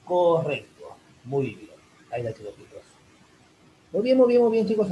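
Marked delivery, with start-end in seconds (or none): 3.9-4.9 excited praise. Real, not acted. Praising students loudly.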